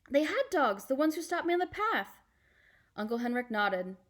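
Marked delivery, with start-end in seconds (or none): 0.1-2.2 put-on high girlish voice, anxious; a child recalling a fright. Anne-Marie telling her uncle about the dogs, high and anxious.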